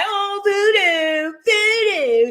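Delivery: high, slow singsong mockery; mock commenter reading. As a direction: mimic whiny commenters in a drawn-out high voice. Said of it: "That's how I be reading".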